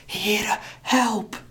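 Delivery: a small cry for help in terror; women praying in fear; frightened cry. Acted. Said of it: "Het is niet meer dan een bange roep".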